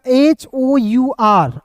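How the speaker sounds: very slow spelling of the letters; spelled out slowly. Shown as a demonstration. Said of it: "slow spelling out letters".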